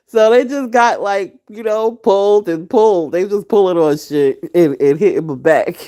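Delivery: loud, laughing retelling. Real, not acted. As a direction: laugh your way through the beating story. Laughing while retelling the beating.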